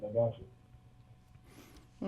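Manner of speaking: distant speakerphone sound; talking from away from the phone. Real, not acted. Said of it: "It sounds like you're on speakerphone".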